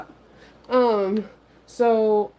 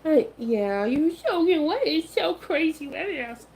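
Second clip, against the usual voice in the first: puppy voice; 6 semitones higher than usual. Real, not acted. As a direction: coo at the puppy. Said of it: "You guys are hearing my puppy voice".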